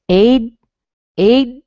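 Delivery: stressed first-syllable drill; isolated syllable. Shown as a demonstration. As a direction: punch out "Aid" alone. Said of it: "if I did this with Aiden".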